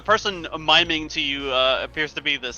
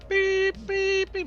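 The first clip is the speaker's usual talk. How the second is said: high imitated reversing beeps; beeping truck; much slower than usual. GM mimicking a truck backing up, high beeps.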